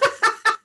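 high shrill witch cackle; witchy cackle. Real, not acted. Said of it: "Didn't I sound like a witch when I just laughed".

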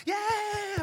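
high excited crowd cheer; mock cheer. Guests rising to cheer the bride, high cheer. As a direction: cheer like the whole wedding crowd.

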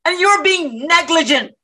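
high, accusing comeback; angry retort. Acted. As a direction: hurl the accusation back. Protester retorts in quarrel.

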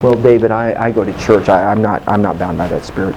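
low, halting imitation of a churchgoer; stammered, subdued mock protest; hesitant objector. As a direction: lightly mimic someone making excuses.

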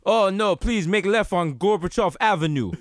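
put-on Russian-accented GPS navigator; mock Russian knockoff of a street guy; stolen-voice Russian accent. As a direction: give directions in a comic Russian accent. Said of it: "they have your accent".

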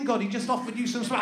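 ordinary delivery

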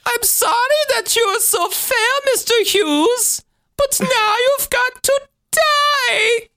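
very high, slow villain voice rising to a shout on die; mad-scientist menace, hammed up; demented German professor. Voicing the killer to Mister Hughes, mad professor. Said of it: "like a demented German professor".

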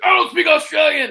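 high, loud wrestler-style shout; shouted character retort. Retold or imitated. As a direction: belt it out as a showman's comeback. Retold in-character retort to a fan.

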